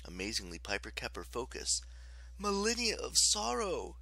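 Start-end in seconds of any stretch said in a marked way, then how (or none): 2.4-4.0 high, sorrowful coaxing; kind, sympathetic. A narrator's reading. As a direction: soothe the goddess with pity. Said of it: "her voice radiating kindness and sympathy".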